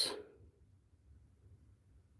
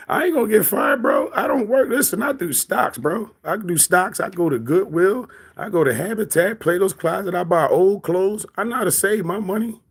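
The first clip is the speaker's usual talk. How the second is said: much higher mocking voice of a broke guy; mock the chatter bragging, pitched way up; mocking impression; 7 semitones higher than usual. Streamer mimicking a chatter who brags about stocks, in a much higher mocking voice.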